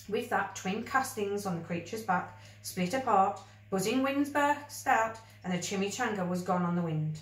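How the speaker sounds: usual speaking voice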